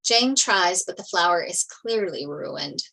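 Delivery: ordinary delivery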